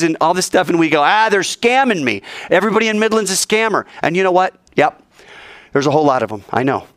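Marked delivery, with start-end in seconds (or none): 1.0-3.9 mock complaint. Mimics suspicious people dismissing beggars.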